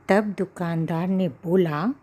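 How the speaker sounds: ordinary delivery, nothing marked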